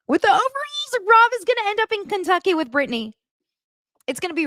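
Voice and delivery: giddy gossip; giddy fan predicting Rob ends up in Kentucky; excited squeal. Real, not acted.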